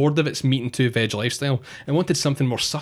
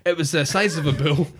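put-on higher-pitched, wobbly Spanish accent; 4 semitones higher than usual. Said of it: "in a Spanish accent".

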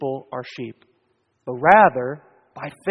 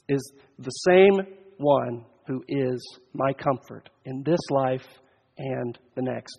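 ordinary delivery

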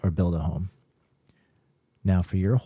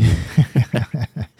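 laughing; 4 semitones higher, 4 dB louder than usual. Real, not acted. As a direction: burst of real laughter. Laughs at drawers joke.

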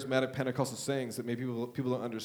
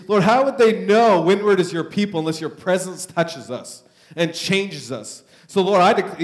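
loud, pleading prayer at full force; fervent prayer; 4 semitones higher, 10 dB louder than usual. Acted. Pastor praying over his church, loud and fervent. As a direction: cry out to God for the church.

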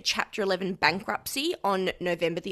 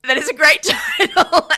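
loud, higher-pitched laughing delight; laughing agreement; 7 semitones higher, 12 dB louder than usual. Real, not acted. Host echoing co-host on the article title, loud laughing agreement. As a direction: agree loudly through laughter.

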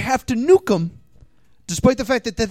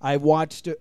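ordinary delivery

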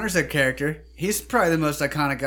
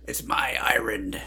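slow, breathy whispered Irish line; whispered brogue imitation; whispered movie quote; 2 semitones lower, slower than usual. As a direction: echo the film line in a hushed whisper. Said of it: "he goes".

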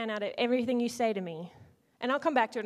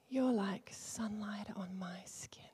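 soft slow whisper; slow tender whisper; 8 dB quieter, slower than usual. Shown as a demonstration. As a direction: breathe it gently like a loving secret. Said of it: "Same phrase, completely different emotion".

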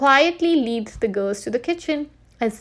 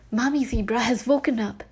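ordinary delivery, nothing marked

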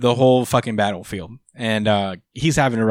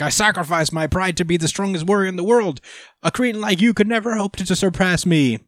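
retold proud villain's rant, pitched up; pissed-off boasting; 7 semitones higher than usual. Podcaster retells Gas's pissed-off boast to Goku, mock indignant. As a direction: sneer at a rival in mock fury. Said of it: "Gas is pissed off 'cause".

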